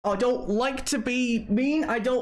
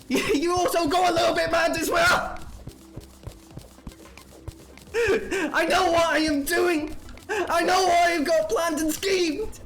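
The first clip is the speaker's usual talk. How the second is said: angry outburst; 7 semitones higher than usual. Real, not acted. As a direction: vent it all at full force.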